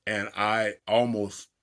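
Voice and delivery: ordinary talk, nothing marked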